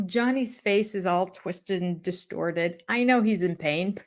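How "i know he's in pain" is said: normal talking voice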